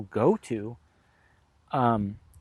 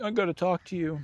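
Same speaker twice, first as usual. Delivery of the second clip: high, softer plaintive voice; mock-tearful, raised pitch; imitating tearful dad; 5 semitones higher, 3 dB quieter than usual. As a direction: mimic a dad on the edge of tears. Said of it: "starting to cry".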